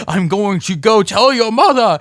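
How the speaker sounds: stern puppet dad. Acted. Puppet skit, dad hand.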